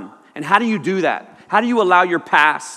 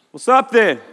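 higher, less forceful mock challenge; lightly acted challenge; 5 semitones higher, 3 dB louder than usual. Speaker facing a monster from the back seat, tentative challenge. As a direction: toss out a half-hearted high challenge.

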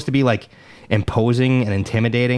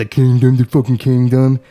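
loud, scornful mock proclamation; mocking grandeur; 5 dB louder than usual. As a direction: boom it out in mockery. Mocking the name of the town.

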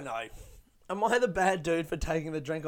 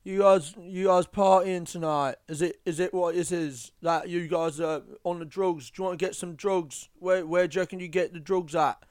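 imitating pushy guy; 3 semitones higher, 5 dB louder than usual. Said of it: "he started to be like".

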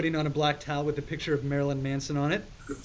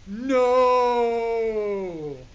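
loud, much higher hammed-up shout; dramatic shout; 6 semitones higher, 7 dB louder than usual. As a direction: cut him off with a big theatrical "No!". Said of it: "he shouted".

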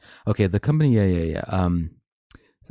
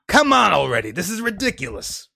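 salmon puppet voice; 10 semitones higher than usual. Acted. Salmon puppet fed up with the article, high exasperated voice. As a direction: shout it high as an improvised puppet. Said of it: "I have not, uh, come up with a voice".

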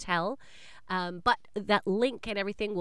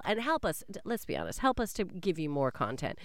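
ordinary talk

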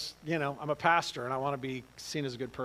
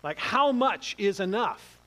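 exasperated; 7 semitones higher, 6 dB louder than usual. Retold or imitated. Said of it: "I'm like".

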